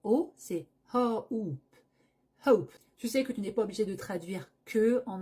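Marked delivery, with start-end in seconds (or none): 0.9-2.7 "hope" with a clear breathed H; aspirated H. Shown as a demonstration. Teacher insists on the H. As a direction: start "hope" on an audible H. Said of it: "Fais bien attention, ce n'est pas O".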